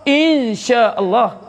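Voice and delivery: Insya Allah drawn out slowly, nasal n hidden into sy; teacher models slow correct Insya Allah; slow ikhfa insya. Shown as a demonstration. Teacher shows pupils the ikhfa, said slowly.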